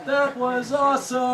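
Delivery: high, slow put-on voice; imitated amazed onlookers. Onlookers reacting to take after take, high awed mimicry. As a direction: mimic awestruck guys watching. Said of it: "and they were like".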